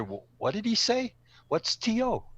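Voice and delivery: baffled, high-pitched questioning; puzzled listener. Acted. Audience member wondering what the speaker just said, puzzled. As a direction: ask it like you missed something, pitch jumping up.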